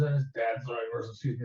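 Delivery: ordinary talk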